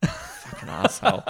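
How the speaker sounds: amused, through breathy laughter; laughing insult. Real, not acted. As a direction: curse your friend while cracking up. Laughing at teasing about costume.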